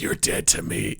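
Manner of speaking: whispered mock menace; abrasive, hurtful. Host showing how Americans mean the swear, hushed mock hostility. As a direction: imitate a cutting, hushed threat. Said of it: "so much abrasiveness to it".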